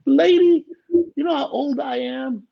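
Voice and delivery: high, loud burst on the first word; exasperated disbelief, pitch shooting way up; retold incredulity. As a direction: reenact a flabbergasted objection. Retells disbelief at doctor suggestion.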